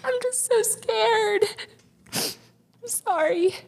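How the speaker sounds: pushing words out through tears, voice cracking high; crying. Real, not acted. Confessing her fear to her partner, crying with a high, breaking voice.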